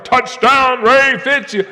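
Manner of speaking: high, loud announcer call; stadium announcer call. Retold or imitated. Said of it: "I never forget hearing them say".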